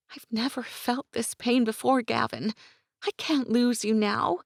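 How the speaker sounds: put-on high, urgent young woman, near tears; young woman's voice. A narrator's reading. Gwen voiced, grieving.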